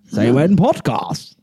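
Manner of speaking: slow, deliberate Yoda-style word order; Yoda speech. Pretend. Host doing Yoda to his co-host, slow and deliberate. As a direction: drawl it out like Yoda, words reversed. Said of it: "talk like Yoda".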